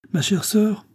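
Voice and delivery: high-pitched public address; said out loud. A narrator's reading. Emma turning to introduce her husband, said aloud. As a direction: announce it openly for all to hear. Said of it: "dit-elle tout haut".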